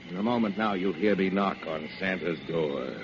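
ordinary talk

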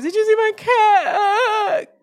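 very high, drawn-out wail; mock weeping. Owner asking neighbors for lost cat, exaggerated tearful wail. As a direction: imitate a sobbing plea, stretched into a wail.

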